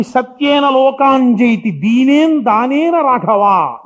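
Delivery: slow, loud, deliberate verse; solemn recitation. Acted. Preacher proclaims the Sanskrit verse to the audience, slow and loud. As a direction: proclaim the verse slowly and loudly. Quoting scripture verse emphatically.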